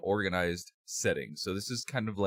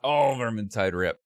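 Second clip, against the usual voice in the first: loud hype; 4 semitones higher, 8 dB louder than usual. Real, not acted. Hyped chant of team rep.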